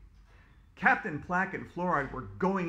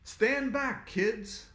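louder, higher heroic shout; 7 semitones higher, 4 dB louder, much slower than usual. Acted. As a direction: defy the villain. Hero warns kids.

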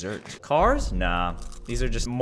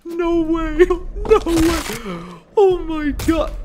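amazed squeal; 5 dB louder, much slower than usual. Real, not acted. Amazed at new ability.

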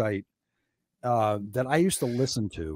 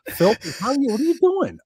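louder, higher, amazed questions; amazed caller; 7 semitones higher, 7 dB louder than usual. Retold or imitated. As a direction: pepper him with baffled questions.

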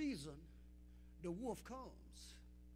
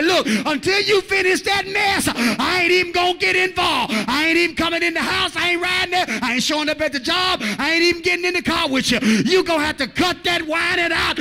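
loud, fed-up God laying down the law; God refusing to come until the whining stops; voicing God; 6 semitones higher, 17 dB louder than usual. Preacher voicing God to the church, loud and fed up. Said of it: "Sometimes God say".

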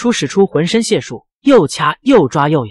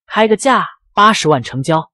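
ordinary delivery, nothing marked

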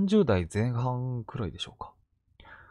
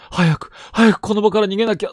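panicked thought; 8 semitones higher, 9 dB louder than usual. Retold or imitated. Paralyzed by a ghost's gaze.

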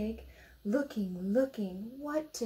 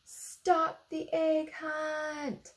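slow, drawn-out, higher; slow announcing; 8 semitones higher, 4 dB louder, much slower than usual. A narrator's reading. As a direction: announce it slowly like a big moment.

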